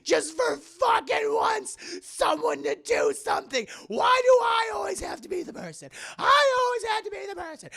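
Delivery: high, loud tearful shouting; screaming rant. Acted. Mother venting at family over dinner, tearful screaming rant. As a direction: scream out years of resentment. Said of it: "And she just loses it".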